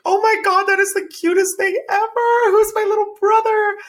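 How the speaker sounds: put-on high, loud gushing baby talk; cooing at little brother. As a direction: squeal over how cute it is. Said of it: "And I was like".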